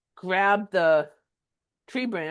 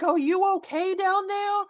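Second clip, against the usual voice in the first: worried call; 11 semitones higher than usual. Retold or imitated. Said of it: "So she's like".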